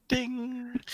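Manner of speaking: high, soft ringing ding; sing-song triangle chime; imitated triangle ding. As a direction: let the ding ring out like a triangle. Mimes playing a triangle.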